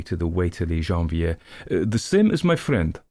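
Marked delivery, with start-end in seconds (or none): 1.7-3.0 put-on a light Eastern European accent, pitched up; ordering offhand in a lightly Eastern European accent. A narrator's reading. Little man ordering from the waiter, light Eastern European accent. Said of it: "an extremely marked Eastern European accent".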